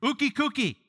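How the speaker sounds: high, punchy motherly no; scolding mom. Pretend. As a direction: snap it out high, forbidding a child. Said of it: "You can say that, moms".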